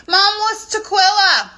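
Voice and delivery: ordinary delivery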